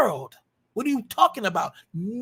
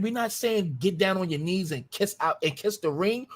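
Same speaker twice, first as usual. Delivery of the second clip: ordinary delivery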